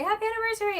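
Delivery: high imitated little girl greeting; child's cheer. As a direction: pipe it up like a child. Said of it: "like, go down and say".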